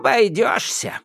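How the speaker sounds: put-on high, defiant old witch; fearless witch. A narrator's reading. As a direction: refuse boldly. Yaga refuses. (Яга бесстрашно отвечает)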